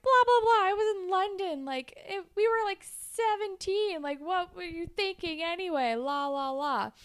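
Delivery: high-pitched, hammed-up argument comeback; mock yelling. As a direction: fire back in a high, mock-indignant voice. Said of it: "they're yelling at each other".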